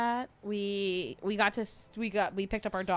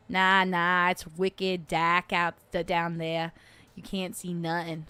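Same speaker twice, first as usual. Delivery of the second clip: put-on lower, drawling New England accent; 3 semitones lower, slower than usual. Streamer mimicking Vermont locals saying wicked, New England accent. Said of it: "New England is my favorite accent".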